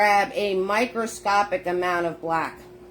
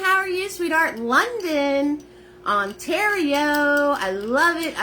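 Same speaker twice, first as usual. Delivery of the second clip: high, bright-eyed hellos to the chat; much higher, cheerful welcome to fans; excited greeting; 10 semitones higher than usual. Real, not acted. Greeting viewers in live chat.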